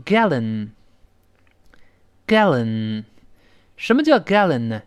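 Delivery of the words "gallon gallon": gallon, gallon, drawn out very slowly; gallon, with a wide spread a; slow model gallon. Shown as a demonstration. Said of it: "大声来一遍".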